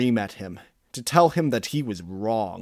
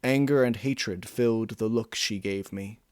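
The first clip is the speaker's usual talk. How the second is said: ordinary delivery, nothing marked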